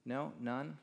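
normal talking voice

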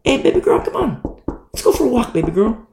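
high-pitched coaxing call; sly tempter calling out high; Satan as tempter. Acted. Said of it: "He wants you to".

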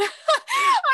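high excited shriek of laughter; excited squeal. Real, not acted. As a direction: squeal with delight. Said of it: "so excited".

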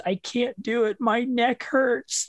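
put-on high, drawn-out little-kid complaint; four-year-old daughter. Four-year-old tells dad she can't manage the impression, high child voice. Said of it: "my four-year-old goes".

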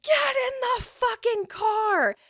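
high-pitched mock scream of rage; furious screaming. Mad Nana ordering her grandchild into the car, imitated furious shout. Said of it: "She's so mad".

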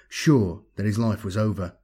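plain reading voice

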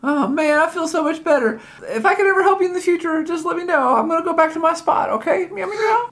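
chatty cartoon cat voice, high; talking cat.

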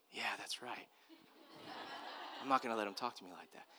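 low, very soft retold boyish resolve; quiet resolve. Eight-year-old agreeing with his dad, hushed resolve. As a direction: say it under the voice, as if convincing yourself.

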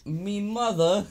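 slow, high mock-British voice; British accent. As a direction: draw it out in a hammed-up British accent. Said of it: "I can do the accent".

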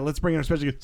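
ordinary talk

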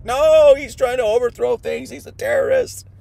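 mock crowd shriek. The stirred crowd accusing Jesus, shrieking high. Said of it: "be like".

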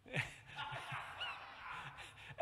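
silent squeaky laugh; breathy laugh. Real, not acted. Preacher laughing at the Miss Betty joke, silent and breathy.

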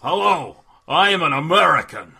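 loud British attempt at an American accent; bad mock-American accent, belted out; botched American accent. Shown as a demonstration. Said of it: "when a British person does an American accent wrong".

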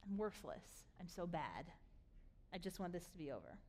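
low, soft, acted despair; depressed prayer. Elijah praying to God in his depression, low and despairing. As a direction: pray it quietly, drained of hope. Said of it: "God meets Elijah in his depression".

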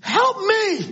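high, pleading acted cry; young man's desperate scream, acted; screaming for mama. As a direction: cry out for your mother's help. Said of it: "screaming for his mama".

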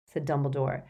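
usual speaking voice

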